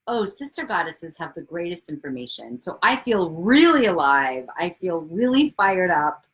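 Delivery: ordinary talk, nothing marked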